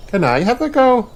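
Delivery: high eager cat voice; bright cartoon cat request; Missus Cat voice. A narrator's reading. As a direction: ask it hopefully. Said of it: "asks Missus Cat".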